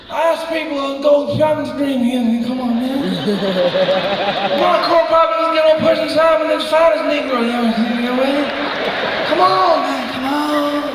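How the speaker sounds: rambling politician, petering into stray interjections; Joe Biden. Acted. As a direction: mumble loudly and lose the sentence midway. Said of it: "that weird mumbling thing and just in the middle of a sentence".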